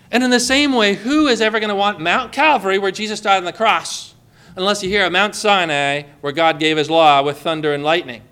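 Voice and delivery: normal talking voice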